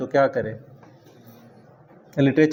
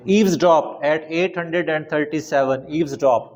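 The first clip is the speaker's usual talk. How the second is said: slow dictation; much slower than usual. Shown as a demonstration. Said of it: "teacher dictates word slowly".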